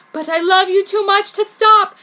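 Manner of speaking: loud, high insistence; pleading. A narrator's reading. Kishu refuses to stop, loud. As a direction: insist with force. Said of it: "he said".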